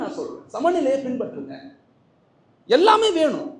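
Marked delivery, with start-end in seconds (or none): 2.7-3.5 loud, high cry; emphatic shout. Acted. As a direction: proclaim it to the hall. Emphatic exclamation.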